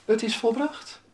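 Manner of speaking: high-pitched call of victory; high, triumphant quote; victorious cry. Acted. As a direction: announce it as the winner would. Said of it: "Als het ware met een overwinning".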